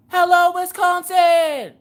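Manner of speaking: loud, high, slow game-show host shout; Drew Carey. Retold or imitated. Greeting chat like a game-show host, booming. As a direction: belt it like a TV host greeting the crowd. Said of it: "Drew Carey just came into my head".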